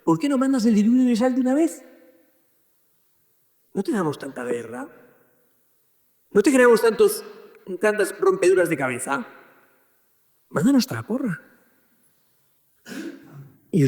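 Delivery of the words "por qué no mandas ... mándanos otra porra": high-pitched, incredulous protest; exasperated pleading. Retold or imitated. Man pestering the Lord with questions, exasperated. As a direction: question God with pitch pushed right up.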